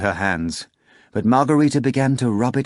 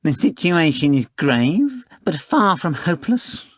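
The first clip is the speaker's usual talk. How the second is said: a proud talking cat weighing things up; a higher, slowed cat character voice; cat Behemoth; 8 semitones higher, 3 dB louder than usual. Acted.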